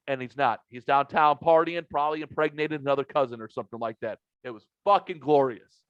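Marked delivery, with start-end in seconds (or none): none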